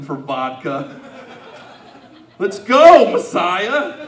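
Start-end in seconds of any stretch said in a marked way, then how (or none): 2.4-4.1 put-on loud, rowdy drunk egging him on; drunken cheer. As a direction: shout it like a trashed party guy. Drunk disciple cheers Jesus on.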